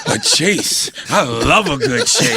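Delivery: slow, campy flirt impression; old flamer. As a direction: savour it slowly like a flamboyant older man. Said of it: "my guy is a old flamer".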